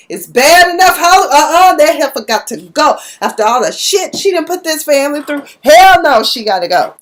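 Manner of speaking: loud, high-pitched mock rant; retold outrage. Aunt ranting about Darla in story.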